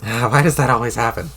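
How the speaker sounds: ordinary delivery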